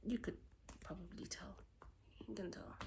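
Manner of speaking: very soft, near whisper; trailing down into a whisper; hushed admission. Real, not acted. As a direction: confide it under your breath. Confiding skipped supplement.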